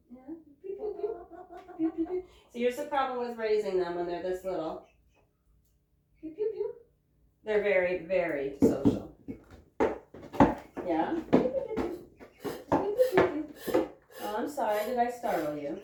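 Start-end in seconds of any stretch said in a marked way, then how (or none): 0.6-2.2 high little "pew" peeps; imitated chick peeps. Woman chirping to her chickens, imitated high peeps. 6.2-6.7 high little "pew" peeps; imitated chick peeps. Woman chirping to her chickens, imitated high peeps. 11.3-13.9 high, louder pew-pew chirps; imitated chick peeps. Woman peeping at her chickens, high imitated chirps.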